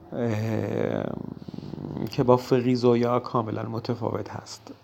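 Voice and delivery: ordinary delivery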